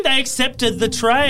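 high, loud announcement; excited reveal. Pretend. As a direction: announce it like a winner. Game show reveal.

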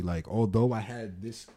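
ordinary talk, nothing marked

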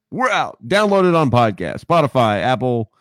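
put-on radio promo read. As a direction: play radio announcer, pitch falling steadily to the close. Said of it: "How's my, my radio voice".

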